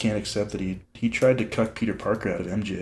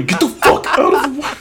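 much higher, louder strained shout; strained yell; 6 dB louder than usual. Acted. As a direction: force it out under heavy weight. Acting out Peter strained again.

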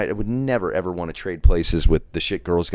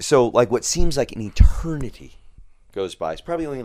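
ordinary talk

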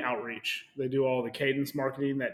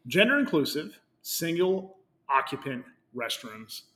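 corporate announcement voice; 4 semitones higher, 6 dB louder than usual. Acted. Reading the corporate post aloud.

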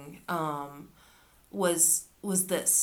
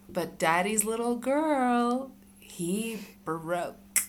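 higher, slower villain taunt; Alistair quote; 4 semitones higher, much slower than usual. Retold or imitated. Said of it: "he says".

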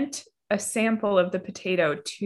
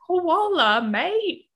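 much higher mock Australian accent; hammed-up Australian accent; 10 semitones higher, 3 dB louder, much slower than usual. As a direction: play up the Australian twang. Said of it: "Australian mode".

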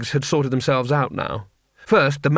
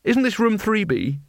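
higher, slow innocent question; bright; 8 semitones higher than usual. A narrator's reading. Rincewind caught by soldiers, bright innocent question. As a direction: play dumb cheerfully. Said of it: "he said brightly".

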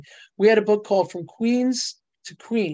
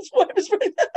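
laughing; 14 semitones higher, 4 dB louder than usual. Real, not acted. Laughing at the surprise twist.

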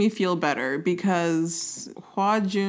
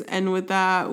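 ordinary talk, nothing marked

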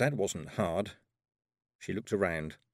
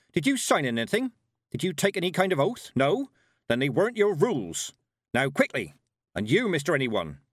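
put-on higher, louder brisk old witch; stern old witch; 5 semitones higher, 5 dB louder than usual. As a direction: hurry them along, no nonsense. Granny scolds and hurries them.

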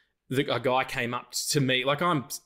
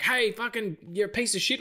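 angry driver; 9 semitones higher than usual. Retold or imitated. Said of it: "they're like".

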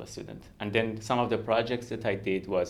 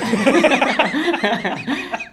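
loud high burst of laughter; hearty laugh; 14 dB louder than usual. Real, not acted. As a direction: laugh out freely at the joke. Laughing at shared joke.

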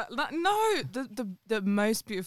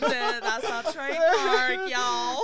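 high, sung-out intro line; hammed-up intro catchphrase; 7 semitones higher than usual. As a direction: belt the catchphrase like a show jingle. Repeating podcast intro.

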